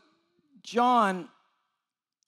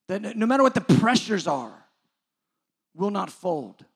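loud, raised preacher's challenge; impassioned preaching; 4 semitones higher, 6 dB louder than usual. Real, not acted. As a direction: press the congregation with forceful, ringing questions.

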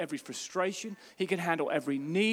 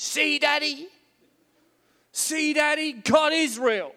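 put-on high excited little girl; daughter's voice; 9 semitones higher, 4 dB louder, slower than usual. Preacher imitating his daughter, high and delighted. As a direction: squeal it like a thrilled six-year-old. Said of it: "she points at me and she says".

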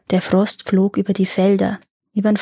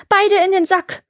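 high, desperate cry; urgent call; 11 semitones higher, 3 dB louder than usual. A narrator's reading. Iwan orders the sticks back, urgent call. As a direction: call it out high, as if being beaten. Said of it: "nach Luft schnappen und rufen".

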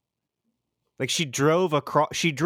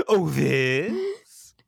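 feigned surprise; 4 semitones higher than usual. Retold or imitated.

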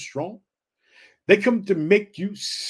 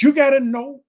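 rising shout; 6 semitones higher, 8 dB louder than usual. Real, not acted. Preacher building to a climax.

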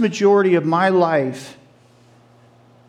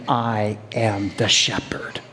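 hushed, slow, lower whisper; soft whispered divine voice; whispered voice of the Lord; 8 semitones lower, 5 dB quieter, slower than usual. Acted. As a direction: breathe the words like a secret.

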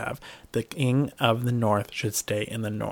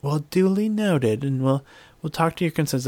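mock prim; 5 semitones higher than usual. S0 mocking how Sansa would politely dodge, prim and high. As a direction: play the haughty lady dismissing them.

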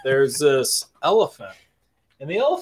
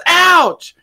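high, loud ouch; elephant yelling ouch; 10 dB louder than usual. Retold or imitated. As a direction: yelp like a struck elephant. Elephant hit by coconut.

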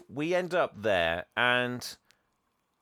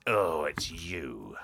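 creepy banker at the door; 4 semitones lower, much slower than usual. Retold or imitated. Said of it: "opens the door and goes".

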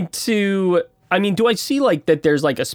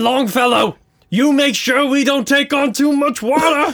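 barking order; 8 semitones higher than usual. Acted. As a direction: bark the order. Captain orders Longfellow.

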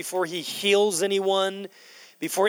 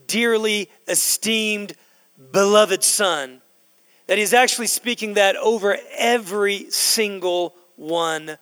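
fervent preaching; 3 semitones higher than usual. Acted. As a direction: preach it out, rising to a shout. Preaching climax, rising intensity.

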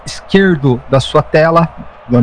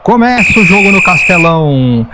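live play-by-play; 3 semitones higher than usual. Acted. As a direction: follow the ball as it moves.

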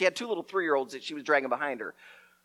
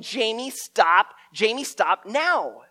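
mock yell; 4 semitones higher, 5 dB louder, much slower than usual.

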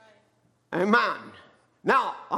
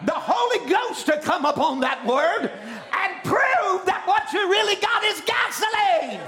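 climbing to a high-pitched sermon climax; impassioned preaching; 6 semitones higher than usual. Real, not acted. Preacher telling believers the word needs fire, impassioned preaching. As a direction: build phrase by phrase into a high, ringing cry.

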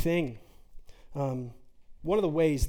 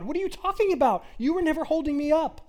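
God, incredulous; 8 semitones higher than usual. Acted. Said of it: "And he says".

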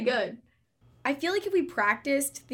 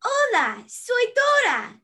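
high cartoon girl voice; sassy little explorer; Dora impression; 5 semitones higher than usual. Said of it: "Dora the Explorer".

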